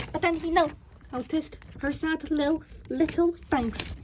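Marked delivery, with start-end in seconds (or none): none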